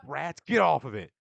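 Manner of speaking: high, loud furious yell at rats on his table; angry shout. Acted. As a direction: bark them off the table. [name] yells at students on table.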